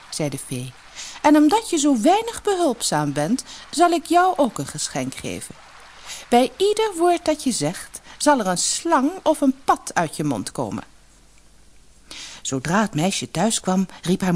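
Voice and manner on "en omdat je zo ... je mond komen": high, measured voice of a displeased fairy; stern fairy. A narrator's reading. Fairy giving an unhelpful girl her punishment, stern. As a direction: pronounce the punishment in a raised pitch.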